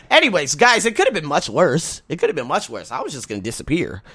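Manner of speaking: ordinary talk, nothing marked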